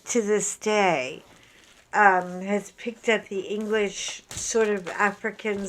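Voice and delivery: normal talking voice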